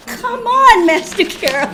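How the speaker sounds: loud, high pleading protest breaking into laughter; mock exasperation. Student to her guide after a stock answer, mock exasperated. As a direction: protest in laughing, hammed-up frustration.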